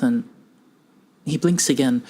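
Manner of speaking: ordinary delivery, nothing marked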